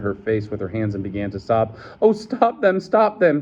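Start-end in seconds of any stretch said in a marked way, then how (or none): 2.0-3.4 high, urgent pleading; imploring. A narrator's reading. Woman sobbing at the whipping, high desperate plea. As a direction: beg in a much higher voice. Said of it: "she implored".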